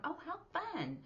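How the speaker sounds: high, bright mock delight; cheerful "oh how fun". Shown as a demonstration. Teacher urging students, acting cheerful delight. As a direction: chirp it like a happy learner.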